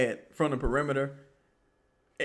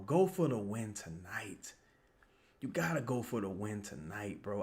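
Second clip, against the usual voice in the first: whisper; 5 semitones lower, 10 dB quieter than usual. Real, not acted. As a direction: breathe it like a private prayer. Whispered repeat of the plea.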